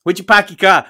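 put-on loud, high Boston accent. Guest echoes the cohost in a loud Boston accent. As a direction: belt out the Boston catchphrase with dropped R's. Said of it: "these Boston accents".

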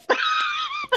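squealing laugh; a shrieking burst of laughter; high-pitched laugh. Real, not acted. Laughing at the German bit.

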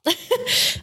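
nervous giggle. Real, not acted. Nervous before reading letter.

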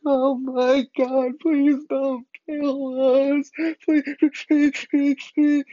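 high, loud, drawn-out wail, imitated; little-kid cry. Boy in his room begging for mercy, mock child's wailing. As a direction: blubber slowly, begging like a small child. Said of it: "that little cry that you get when you're little".

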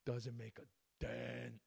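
slow, altered voice echoing the catchphrase; quiet slow repeat of the catchphrase; quoting Crystalia softly. Retold or imitated. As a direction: echo it back, savouring it. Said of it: "As Crystalia would say".

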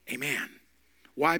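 usual speaking voice

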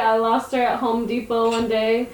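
usual speaking voice

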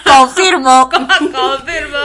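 slow, loud laughing confirm; laughing confirmation. Real, not acted. Confirming her friend's claim, slow loud laugh. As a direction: laugh through it, drawn out.

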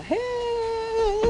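ordinary delivery

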